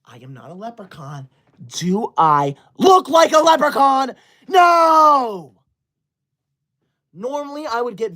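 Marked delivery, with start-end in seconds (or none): 0.0-1.3 much softer, sulky murmur; muttered under breath. Acted. Streamer sulking about the hat, muttering. 1.7-5.5 higher, loud hammed-up rant; mock furious shout. Streamer shouting at chat over the hat, mock furious.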